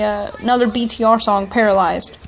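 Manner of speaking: normal talking voice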